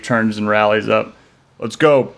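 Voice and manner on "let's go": commanding. Acted. Chief rallies officers.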